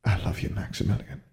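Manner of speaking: softly murmured, under the voice. A narrator's reading. Mother saying goodbye to her son at the station, murmured softly. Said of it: "Speaking in a much lower voice, she murmured".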